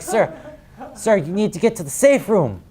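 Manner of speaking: high, loud urgent servant plea; alarmed butler. Acted. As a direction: urge the master to safety. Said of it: "he's like".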